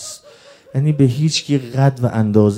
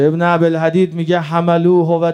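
raised, urgent preaching lament; mourning sermon; 6 semitones higher, 6 dB louder than usual. Real, not acted. Preacher recounting the assault on Ali to mourners, urgent lament. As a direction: press the grief on the congregation in long chanting phrases.

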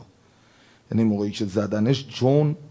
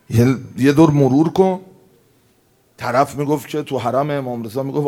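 usual speaking voice

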